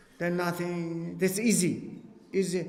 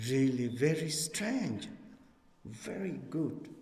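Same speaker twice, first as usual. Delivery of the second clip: hushed wonder; 4 semitones lower, 7 dB quieter than usual. Retold or imitated. Student's inner amazement.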